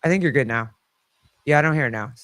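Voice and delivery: normal talking voice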